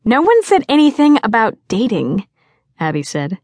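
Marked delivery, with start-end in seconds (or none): 0.0-2.3 high, loud objection; teasing protest. A narrator's reading. Friend answering "I'm not dating this year", protesting. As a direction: pounce on her excuse.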